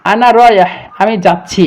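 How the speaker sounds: normal talking voice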